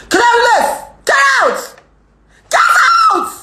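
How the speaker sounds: high-pitched full-force shout; demonstrative shout. Shown as a demonstration. Streamer showing complainers how loud she can shout, high screamed shout. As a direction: scream it loud enough to hurt eardrums. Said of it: "Is that shout enough?".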